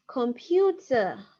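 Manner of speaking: "Computer." repeated slowly, falling. Shown as a demonstration. Teacher repeating "Computer." slowly for the class. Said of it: "How do you say computer?".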